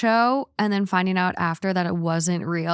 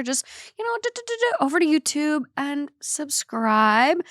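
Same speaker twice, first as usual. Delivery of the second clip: squeaky high voice; 7 semitones higher than usual. Pretend. Playful urging to subscribe.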